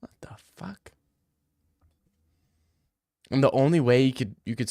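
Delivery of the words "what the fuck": whispered "what the fuck"; hushed, baffled aside; mock confused whisper. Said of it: "They're like".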